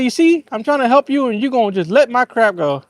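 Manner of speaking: high, loud scolding of a careless friend; mock-angry rant; retold cussing. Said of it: "Well, I sure was cussing".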